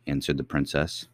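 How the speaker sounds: usual speaking voice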